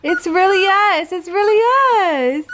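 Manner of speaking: high soothing voice to the dog; calm the dog in a high coo; reassuring pet talk. Real, not acted.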